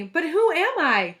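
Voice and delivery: high, loud, bewildered cry; puzzled shout. A narrator's reading. Jenny doesn't know whose voice she has, shouting. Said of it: "shouted Jenny".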